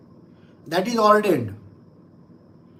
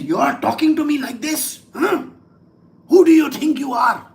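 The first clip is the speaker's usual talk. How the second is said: raised, indignant outburst; high, loud acted outrage at being insulted; angry demon; 4 semitones higher, 4 dB louder than usual. Provoked demon to the Lord, angry. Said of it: "the demon gets very angry".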